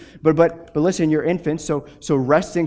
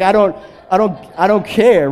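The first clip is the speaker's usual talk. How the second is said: higher, louder mock tough guy who couldn't care less; Russian swagger; 5 semitones higher, 7 dB louder than usual. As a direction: shoulders back, brushing it off. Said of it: "this swagger that certain older Russians have".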